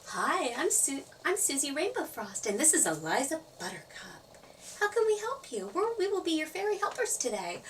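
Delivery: high-pitched, eager little fairy voice; perky fairy helper. Acted. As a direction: introduce yourselves as cheery helpers. Fairy helpers introduce themselves.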